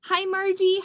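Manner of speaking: plain reading voice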